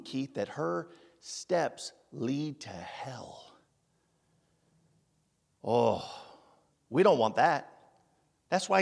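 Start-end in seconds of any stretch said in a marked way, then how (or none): 0.0-3.5 slow, hushed warning whisper; a slow ominous whisper about hell. Acted. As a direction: lean in and breathe the warning.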